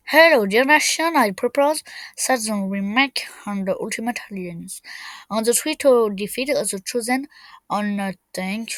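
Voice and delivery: English declaimed in a put-on English accent; showy English accent. Shown as a demonstration. Player announces an alliance message, showy English accent. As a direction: show off your supposedly fine English accent. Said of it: "pour montrer mon bel accent anglais".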